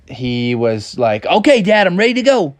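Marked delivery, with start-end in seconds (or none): 1.3-2.5 put-on higher, eager kid voice; eager young Froggy. Froggy tells his dad he's set to go, eager. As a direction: play a keen little frog.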